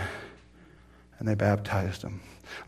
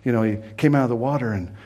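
usual speaking voice

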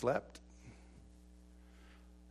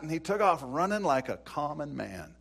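ordinary delivery

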